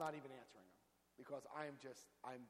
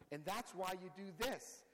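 ordinary talk, nothing marked

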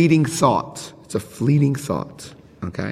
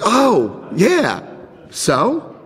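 clueless shrug; 9 semitones higher, much slower than usual. Retold or imitated. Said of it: "I was like".

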